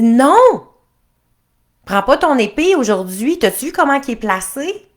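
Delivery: high, loud commanding princess voice; bossy princess. Acted. Princess ordering the prince to drop his sword, loud and bossy. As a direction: bark orders at him, shrill with insistence.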